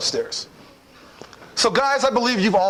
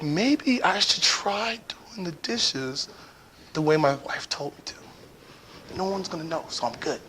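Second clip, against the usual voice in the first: whispered thoughts; 6 dB quieter than usual. Retold or imitated. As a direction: whisper so no one hears. Whispering thoughts, nobody awake.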